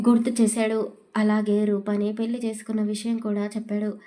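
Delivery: ordinary delivery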